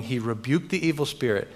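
usual speaking voice

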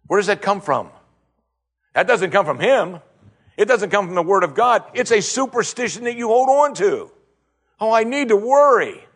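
high-pitched, emphatic rebuttal from the pulpit; exasperated preacher building to a mocking cry; impassioned preaching. Preaches at full force.

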